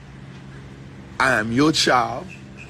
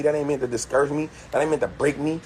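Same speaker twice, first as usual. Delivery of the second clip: normal talking voice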